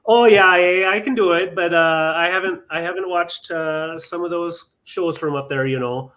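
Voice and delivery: chirpy Minnesota accent, a bit exaggerated. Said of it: "Can you do a Minnesota accent?".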